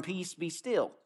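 Jesus calming the storm, softly commanding. Retold or imitated. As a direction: still the storm with a soft word. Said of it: "he said to the storm".

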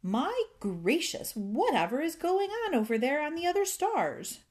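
the fairy cries out in alarm at the noise. A narrator's reading. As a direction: gasp it out, alarmed. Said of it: "and cried".

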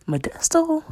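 Modesto popped up high in mock disbelief. Real, not acted. Telling friends where the date came from, mocking.

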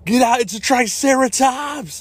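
high, hammed-up alarm; mock panicked warning. Soldier warning of a triceratops in fake trailer, mock panic. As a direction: cry out a B-movie warning.

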